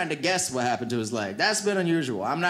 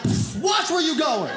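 tough pug; 6 semitones higher, slower than usual. Retold or imitated. Pug shaking off the car.

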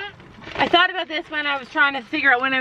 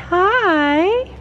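1 sing-song hi; 8 semitones higher, 4 dB louder than usual. Real, not acted. Greeting pony.

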